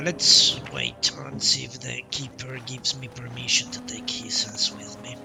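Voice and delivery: normal talking voice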